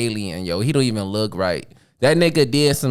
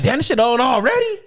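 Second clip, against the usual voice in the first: high-pitched mock disbelief; surprised outburst; 11 semitones higher than usual. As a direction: retell it as a startled, high exclamation. Retells surprise at game starting.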